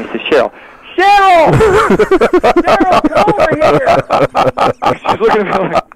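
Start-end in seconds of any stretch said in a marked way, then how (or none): 1.0-5.0 high, loud holler of a name; calling out. Retold or imitated. Man hollers for Cheryl to come over, high drawn-out call. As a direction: shout to someone across the room, drawn out.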